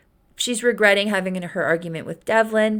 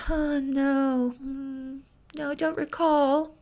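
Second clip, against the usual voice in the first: soft, higher, evasive denial; feigned forgetfulness; 5 semitones higher, 4 dB quieter, slower than usual. Retold or imitated. Woman dodging a man who recognizes her, feigned forgetfulness. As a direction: play innocent in a small, higher voice. She feigns not knowing him.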